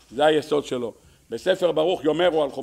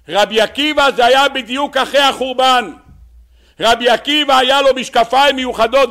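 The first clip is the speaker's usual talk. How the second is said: raised, high, forceful preacher's delivery; impassioned preaching; 9 semitones higher, 9 dB louder than usual. Real, not acted. Rabbi telling listeners of Rabbi Akiva's special glasses, impassioned preaching.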